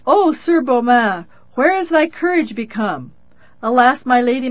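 loud, pleading cry to the knight. A narrator's reading. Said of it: "Then cried the maiden Lynette on high".

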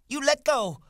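piercingly high, loud demand; cried out. A narrator's reading. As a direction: shriek it as a struggling woman. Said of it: "she cried".

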